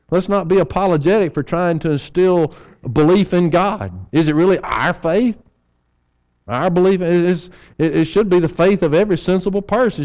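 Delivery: a preacher urging his church to teach faith, fervent; preaching at full force. Acted. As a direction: press the point from the pulpit. Preaching sermon.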